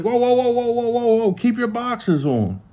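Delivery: high string of startled protests; alarmed halting. Retold or imitated. Guards stopping a cellmate who strips too far, alarmed.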